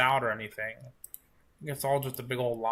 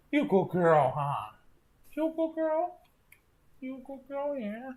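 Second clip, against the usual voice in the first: puppy praise voice; 12 semitones higher than usual. Real, not acted.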